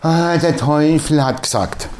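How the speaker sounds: slow, whiny der Teufel hat gesagt; mock excuse. Mocking Christians blaming the devil, slow whine. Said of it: "Komm mir nie wieder und sag".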